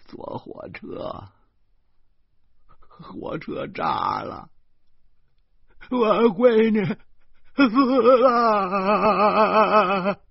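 slow, breathless then loud, high wail; grandma wailing that the train blew up; old woman wailing. Acted. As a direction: break into sobs on the last words. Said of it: "这老太太哇哇地哭起来了".

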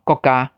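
国家 said with a clipped stop after 国; Taiwanese word demo. Shown as a demonstration. Teacher modelling Taiwanese 国家 for learners, clipped stop. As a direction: cut 国 short before 家. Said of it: "比如讲".

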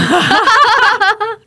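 a burst of high, loud laughter. Real, not acted. Guest laughing at her own line to the host, high laugh.

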